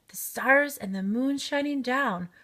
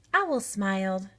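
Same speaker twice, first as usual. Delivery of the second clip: ordinary delivery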